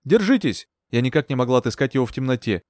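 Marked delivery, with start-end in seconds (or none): 0.0-0.7 high-pitched shout of encouragement; urgent call. A narrator's reading. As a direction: call out to someone struggling. Rescuer calls to drowning man.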